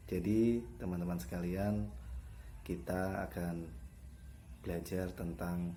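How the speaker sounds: soft, indistinct mumble fading away; unclear articulation. Shown as a demonstration. Teacher shows pupils how not to articulate, unclear articulation. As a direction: let the words blur and sink. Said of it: "nggak jelas kan ngomong apa?".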